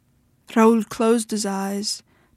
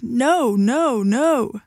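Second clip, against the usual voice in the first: higher, drawn-out protest on each no; wailed refusal; 3 semitones higher, much slower than usual. A narrator's reading. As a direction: wail it, swooping up and down. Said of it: "he shouted".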